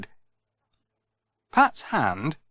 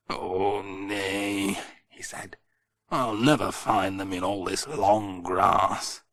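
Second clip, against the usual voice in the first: lower, softer, down-hearted farmer voice; glum Ted; 5 semitones lower, 5 dB quieter, slower than usual. Acted. Ted to Pat on his knees in the grass, glum and low. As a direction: sigh over the lost screws. Ted dejected about lost parts.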